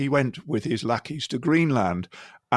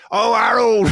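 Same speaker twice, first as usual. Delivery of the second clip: higher, louder exasperated wail; Trump as Steptoe; 6 semitones higher, 7 dB louder, slower than usual. Retold or imitated. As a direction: Trump doing a Steptoe moan. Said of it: "Donald Trump's kind of got a bit of".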